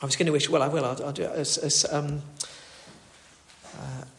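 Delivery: ordinary delivery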